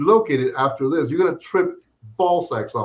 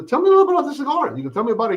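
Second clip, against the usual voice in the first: mock eager question; 10 semitones higher, much faster than usual.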